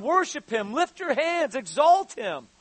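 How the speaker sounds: ordinary delivery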